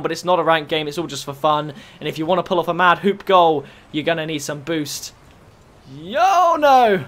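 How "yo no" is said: high, drawn-out protest; swooping high yelp; dismayed cry. Real, not acted. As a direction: wail it in surprise as you miss. Missing the ball.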